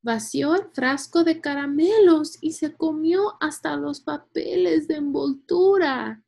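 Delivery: put-on high, loud kid reporting mischief; aghast child. A narrator's reading. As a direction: exclaim it in scandalized disbelief.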